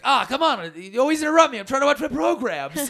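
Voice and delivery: high, whiny grumble; high husband griping at his wife during his show; grumpy complaint. Retold or imitated. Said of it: "I'll be like".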